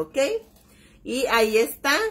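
ordinary talk, nothing marked

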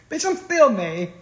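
ordinary talk, nothing marked

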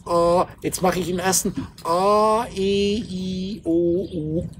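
slow, drawn-out "A, E, I, O, U"; drawn-out vowels. Shown as a demonstration. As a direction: open the mouth and draw each vowel out slowly. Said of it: "Ich mache den Mund auf". (langsam gedehnte Vokale "A, E, I, O, U")

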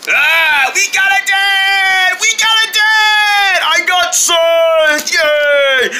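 high, slow, joyful shouts of delight; over-the-moon squeals; excited cheering. Acted. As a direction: cheer like you just got a family. Kids cheer over new dad.

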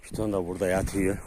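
normal talking voice

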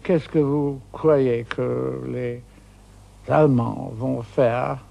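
put-on slow, careful English-accented French; gallantly attempted French in a slow English accent. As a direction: try your best schoolboy French, slowly. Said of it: "gallantly in French".

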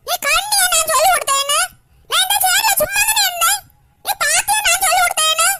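put-on high, loud indignant child shouting; angry quarrel. Accused child shouting back at Vandana in anger. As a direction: hurl the accusation back hard. Kids quarrel over cheating.

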